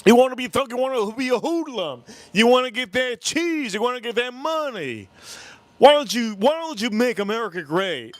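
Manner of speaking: high, pleading scolding, imitated; worked-up high-voiced counselor impression; mad counselor. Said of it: "He got so mad, the counselor".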